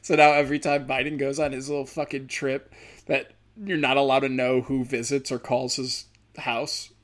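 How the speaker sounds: usual speaking voice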